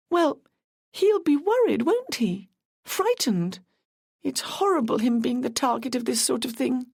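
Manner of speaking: a voiced young woman, a touch anxious; young Carla. Carla defends Brendan.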